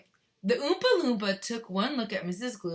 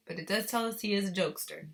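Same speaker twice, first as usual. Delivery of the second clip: plain reading voice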